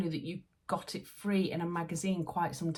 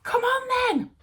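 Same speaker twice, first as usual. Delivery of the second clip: excited calling; 11 dB louder than usual. Real, not acted. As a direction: call out to them, thrilled. Calling to kids about ice cream.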